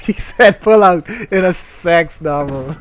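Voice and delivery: high squealing laughter; laughing hysterically. Real, not acted. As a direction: crack up at the joke. Laughing at innuendo.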